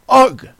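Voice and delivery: high, loud shudder of disgust; shuddering "ugh". A narrator's reading. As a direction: let out a sharp, shivery ugh. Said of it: "said the Lory with a shiver".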